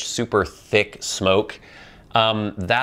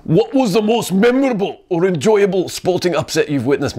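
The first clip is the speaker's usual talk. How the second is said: higher, louder hammed-up Scottish accent on "What was the most memorable or enjoyable"; 10 semitones higher, 4 dB louder than usual. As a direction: go big with the bad Scottish accent. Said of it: "I'm doing a horrible accent right now".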